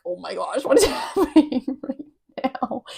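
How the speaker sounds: giggling through the words; laughing disbelief. Retold or imitated. Ten-year-old watching the finale, laughing in disbelief. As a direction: laugh while asking in shock. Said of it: "I was like".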